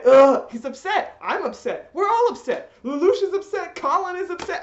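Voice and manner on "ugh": usual speaking voice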